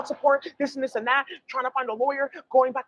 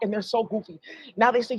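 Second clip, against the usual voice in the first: ordinary talk, nothing marked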